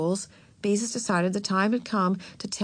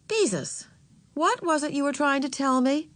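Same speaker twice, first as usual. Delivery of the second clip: higher-pitched, interested aunt; encouraging aunt; 8 semitones higher than usual. A narrator's reading. Aunt asking niece to go on, encouraging. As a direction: invite her in with lifted pitch.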